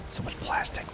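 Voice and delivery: hushed, voiceless whisper; whispered dismay. Real, not acted. Lamenting all the plastic, whispered. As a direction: whisper it in disbelief.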